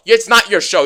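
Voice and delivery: ordinary talk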